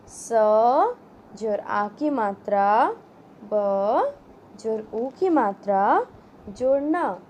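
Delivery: put-on slow, spelled-out syllables for children; syllable drill. Shown as a demonstration. Teacher sounding out gulab for children, slow and clear. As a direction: build the word sound by sound.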